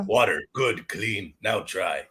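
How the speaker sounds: caveman voice. Pretend. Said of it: "like a fucking Neanderthal".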